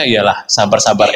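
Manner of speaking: low, grudging mock agreement under the breath; sarcastic inner voice. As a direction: roll your eyes at the advice inwardly. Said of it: "dalam batin saya". (sinis dalam hati, nada rendah)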